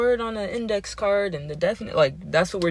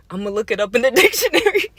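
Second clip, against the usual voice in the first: raised voice dissolving into laughter; cracking up; 4 semitones higher, 5 dB louder, faster than usual. Retold or imitated. Retelling the kid's dictionary line, unable to hold laughter in. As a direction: burst out laughing mid-quote.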